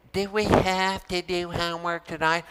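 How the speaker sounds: quiet, sulky whine; mock the bored student's groan; whiny complaint. Shown as a demonstration. Teacher showing the wrong way to ask about homework, mock whine. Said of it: "But don't say".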